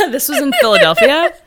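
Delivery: laughing. Real, not acted. Laughing correction of title.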